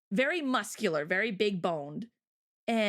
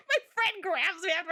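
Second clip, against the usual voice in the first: normal talking voice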